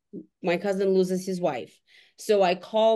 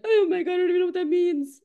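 much higher, fast flustered "Oh my God"; shocked panic; 10 semitones higher, faster than usual. Retold or imitated. Woman on the phone, told to learn empathy, flustered and much higher. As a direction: squeak out your bewildered alarm. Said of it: "And I'm like".

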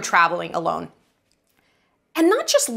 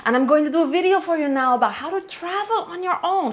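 hammy put-on Spanish accent, half-joking; mock Spanish accent. To her viewers from Mexico, in a playful mock Spanish accent. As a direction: greet the fans like a bad Spanish tourist-guide impression. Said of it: "Should I do it in this accent?".

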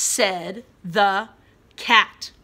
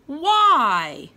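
loud question; 5 semitones higher, 7 dB louder than usual. Shown as a demonstration. Reading question word expressively.

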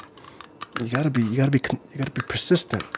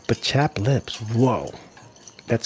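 ordinary talk

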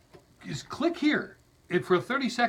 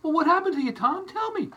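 high, pleading mock eagerness; pitched-up begging to the video guy; eager pleading; 9 semitones higher than usual. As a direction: beg Tom to spill it. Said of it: "You go".

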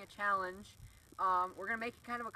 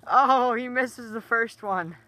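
excited; 2 semitones higher, 13 dB louder, slower than usual. Real, not acted. Live commentary on a miss.

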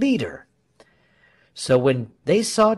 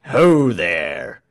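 loud, low soldier's shout; deep, loud hail; 4 semitones lower, 7 dB louder than usual. A narrator's reading. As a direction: bellow a challenge to halt someone. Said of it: "shouted Frieshardt".

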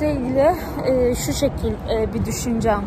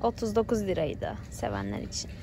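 quiet at night; 9 dB quieter than usual. Real, not acted. Talking softly inside the shop.

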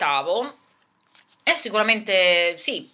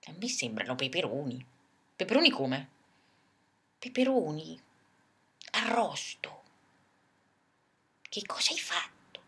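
much softer, sniffing the air; hushed suspicion; 5 semitones lower, 10 dB quieter than usual. Acted. Zupla whispers to the giraffe, hushed and suspicious.

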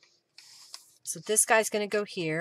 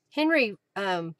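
normal talking voice

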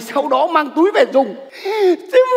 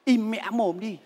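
hushed; 4 semitones lower, 10 dB quieter than usual. Acted. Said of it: "Cứ gào ầm to như thế".

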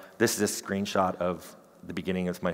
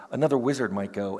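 ordinary talk, nothing marked

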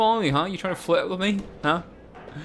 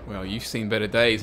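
ordinary delivery

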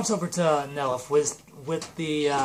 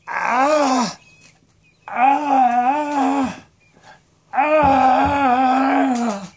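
high, loud screams, each longer; repeated cartoon wails of terror; horrified screams; 10 semitones higher, 9 dB louder, much slower than usual. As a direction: scream in hammed-up horror. Character sees horrifying photo.

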